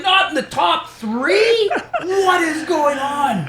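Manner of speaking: high, slow, drawn-out disbelief; incredulous outcry. Retold or imitated. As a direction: wail it, stretching every word in astonishment. Retold shock at losing.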